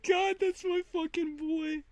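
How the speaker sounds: overjoyed, cheering for a friend; thrilled outburst. Real, not acted. Cheering as a friend catches the tornado, voice leaping high. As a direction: voice leaping high on the last words.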